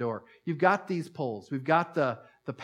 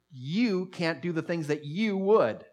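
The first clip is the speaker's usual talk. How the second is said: both "you" words stretched and raised; stress on both yous; 4 semitones higher, slower than usual. Shown as a demonstration. Preacher shows two yous in the verse, stress on each "you". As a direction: lean hard and high on each "you", slowly. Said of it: "There's two yous in that passage".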